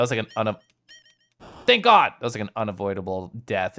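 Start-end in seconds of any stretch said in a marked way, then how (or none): 1.7-2.1 relieved exclaim. Real, not acted. Relief after escape.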